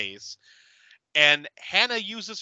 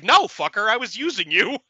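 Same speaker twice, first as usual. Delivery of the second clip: higher, louder hammed-up sass; mock snarky retort; 6 semitones higher, 4 dB louder than usual. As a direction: toss it off like a cruel confession. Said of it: "She's like".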